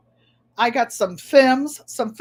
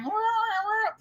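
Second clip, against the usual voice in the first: mock whining chatter; 11 semitones higher, 5 dB quieter than usual. Truckers scramble on CB.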